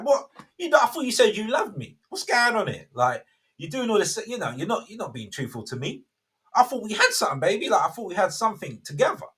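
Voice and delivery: hammed-up whiny lover demanding the truth; fuming Delilah. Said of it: "She's like".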